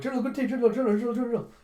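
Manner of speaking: high, loud urgent calls of General; frantic calling out. Retold or imitated. Chul running in calling the general. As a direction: shout for the general in a panic. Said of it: "as Chul comes running and goes".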